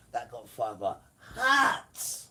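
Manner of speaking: slow, loud burst; loud preaching. Acted. Preacher bellowing that Father got angry, slow and loud. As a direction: bellow it out slowly.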